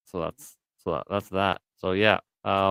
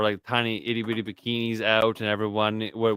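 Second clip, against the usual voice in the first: normal talking voice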